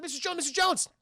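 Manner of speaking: high, anxious mock call; calling after someone. Man follows Missus Jones outside, calling anxiously.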